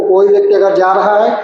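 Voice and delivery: ordinary talk, nothing marked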